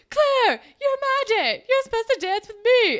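loud, high mock outburst; hammed-up jilted date shouting across a hallway; so dramatic. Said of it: "it's so fucking dramatic".

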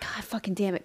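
real whisper. Real, not acted. Cat hair in her mouth.

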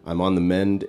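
usual speaking voice

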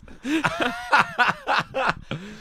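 long, high-pitched laughing fit; real laugh. Real, not acted. Patient cracking up at his own awkward joke, high laugh. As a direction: laugh out loud, high and sustained.

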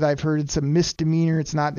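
ordinary talk, nothing marked

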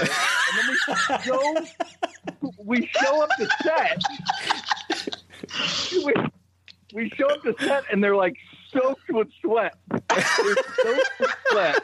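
laughing through the words; cracking up mid-sentence. Real, not acted. Laughing hard while telling.

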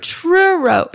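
churro said high as choo-roh; demo churro. Shown as a demonstration. Teaching his cohost the right churro. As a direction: model churro for her to repeat. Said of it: "Say it".

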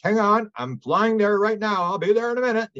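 high, stretched-out call; high-pitched mock report from mid-flight; acted remote viewer.

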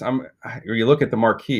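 ordinary talk

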